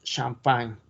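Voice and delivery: Champagne said shampan, French style; French pronunciation. Shown as a demonstration. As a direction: model the French word. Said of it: "pronunciar bien las palabras francesas". (Champagne con pronunciación francesa)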